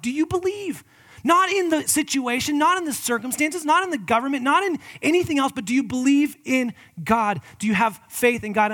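high, loud rising challenge; preaching climax. Acted. Pastor challenging his listeners' faith, loud and high. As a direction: hammer each "not" up to the question.

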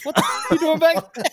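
high, loud incredulous yell; loud startled shout down the phone; shocked yell. Retold or imitated. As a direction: bellow at him in total disbelief. Boss yells on the phone.

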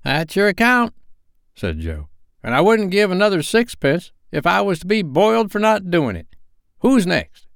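put-on high, loud creaky old fence; sly old pawnbroker welcoming them in; old Joe's voice. A narrator's reading.

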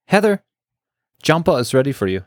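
high, quick cheery call; lightly voiced stoner calling her name; cheerful stoner. A narrator's reading. Stoner receptionist calling Heather in, cheerily. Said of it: "called her name cheerily".

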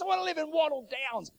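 high-pitched wife imitation; wishful wife. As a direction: declare the wish in a put-on high voice. Wife names her dream suburb.

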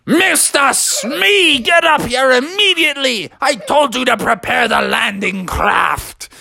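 high, stretched bellow; scolding captain. A narrator's reading. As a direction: holler at a useless first mate. Captain scolds sunken Smee.

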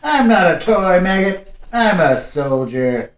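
gruff soldier. Acted. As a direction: growl it through an iron grip. Chip grabs the teen.

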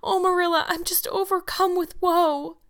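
high, loud-edged theatrical lament; wailing. A narrator's reading. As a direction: build in pitch to overwhelmed woe. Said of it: "she wailed".

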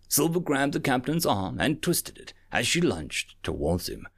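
ordinary delivery, nothing marked

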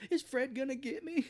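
put-on high, scared little-kid question. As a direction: squeak it like a frightened child. Kid's imagined fearful question.

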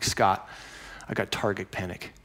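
mopey low complaint to the archery coach; glum confession. Retold or imitated. Confessing problem to shop owner.

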